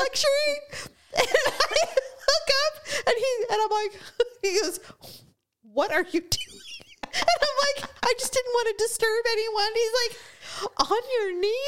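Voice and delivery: high, squeaky laughing speech; talking through laughter. Real, not acted. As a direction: struggle to get the story out while cracking up. Telling own story while cracking up.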